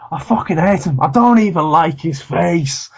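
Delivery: Ross Noble quote. Retold or imitated. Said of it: "and Ross Noble was like".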